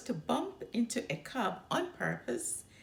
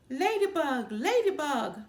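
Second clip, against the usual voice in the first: louder, higher mocking singsong; 6 semitones higher, 5 dB louder than usual. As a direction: taunt her by echoing her chant. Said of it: "mocked Too Tall".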